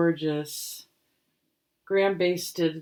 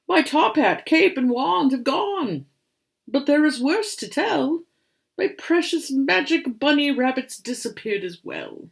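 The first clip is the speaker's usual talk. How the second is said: put-on higher, lamenting old conjurer; worried grandpa voice; 9 semitones higher, 7 dB louder than usual. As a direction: mourn the lost rabbits with theatrical woe. Grandpa laments lost props.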